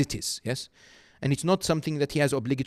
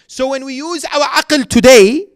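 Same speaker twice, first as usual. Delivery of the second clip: raised, pitched-up sermon delivery; preaching at full force; 10 semitones higher, 11 dB louder than usual. Acted. Preacher voices the young questioners, loud and emphatic. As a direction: press the youths' question on the hall.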